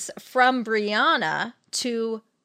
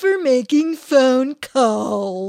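mock old-timer; 7 dB louder, slower than usual. Playing a clueless old-fashioned person.

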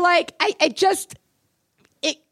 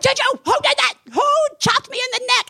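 panicked question; 14 semitones higher than usual. Retold or imitated. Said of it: "I'm like".

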